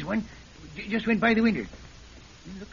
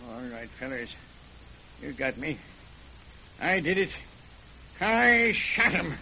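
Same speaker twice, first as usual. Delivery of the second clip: broken confession; 7 semitones lower, 5 dB quieter than usual. Acted. Caught killer confessing to the sheriff, low and broken. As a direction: give up, each line heavier.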